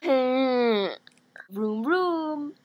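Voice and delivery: playful drawn-out "vroom vroom"; silly car-engine imitation, rising at the end; engine revving noises. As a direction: rev up like a toy car.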